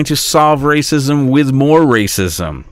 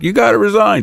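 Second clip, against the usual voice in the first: higher-pitched mock demand; mimicked demand; 8 semitones higher, faster than usual. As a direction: imitate someone demanding it, pitched up.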